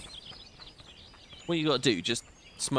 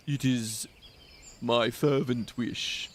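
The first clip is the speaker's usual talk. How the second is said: slower, lightly voiced old man; old man voice retry; 3 semitones lower, slower than usual. Streamer retrying the Macon old man voice, very slow. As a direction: drag it out like a frail old man. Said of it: "how do you do an old man voice".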